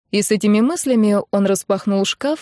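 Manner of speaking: plain reading voice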